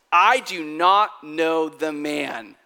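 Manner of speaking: slow, loud, emphatic acted denial; cursing denial. Peter cusses out the servant girl, slow angry denial. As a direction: hammer out each word in mock anger. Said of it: "Peter cusses out this thirteen-year-old girl".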